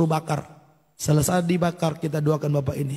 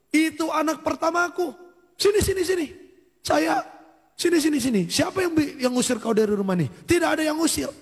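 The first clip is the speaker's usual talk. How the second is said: acted high-pitched protest; agitated, re-enacted fatherly outburst, pitched far up; indignant father; 11 semitones higher than usual. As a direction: play a father urgently calling his child over. Acts father indignantly reclaiming his son.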